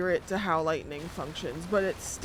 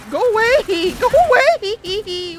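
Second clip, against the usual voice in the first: laughing panic; 11 semitones higher, 9 dB louder, slower than usual. Real, not acted. Pleading with a monster.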